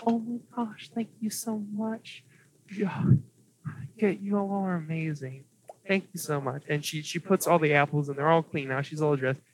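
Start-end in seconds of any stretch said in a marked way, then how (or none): 0.1-6.6 higher, softer, lightly voiced grateful girl; grateful elf girl. Elf girl quietly thanking the party for cleaned apples, grateful. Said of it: "and she's".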